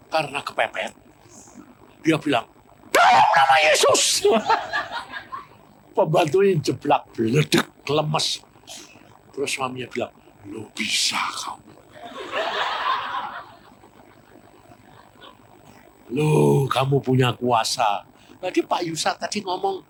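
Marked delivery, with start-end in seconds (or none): 2.9-4.3 teriak tinggi dan keras; teriakan pengusiran setan. Retold or imitated. Suami berteriak mengusir setan. 4.3-5.4 tawa lepas bernada tinggi. Real, not acted. Pengkhotbah tertawa. 10.5-18.1 bisikan heran yang pelan; heran sambil tertawa. Retold or imitated. Suami terheran setelah berhasil.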